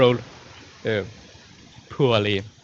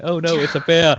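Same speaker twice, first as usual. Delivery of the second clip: loud, high panicked cry for help; mock panic; 6 semitones higher, 6 dB louder than usual. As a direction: yell for help as a bear chases you. Player acts panicked fleeing bear.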